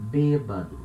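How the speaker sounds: "bêbado" as "BAY-ba-du", no glide; closed accented E. Shown as a demonstration. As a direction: stress a narrow first e in "bêbado". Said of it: "will have the sound of E".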